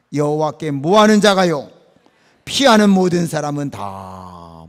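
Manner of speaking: loud, declamatory Bible reading; forceful, drawn-out recitation; leading scripture reading. Acted. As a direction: proclaim the verse for a congregation to follow. Leads congregation in scripture reading.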